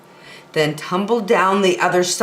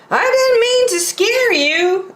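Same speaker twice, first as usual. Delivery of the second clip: much higher cartoon buffalo voice; Boris Buffalo voice. Boris Buffalo greeting startled Bear, high character voice.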